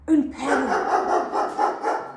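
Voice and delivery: very slow, drawn un perro rising; slow reveal. Acted. Host reveals the dog to kids, slow and drawn out. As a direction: draw it out as a surprise reveal.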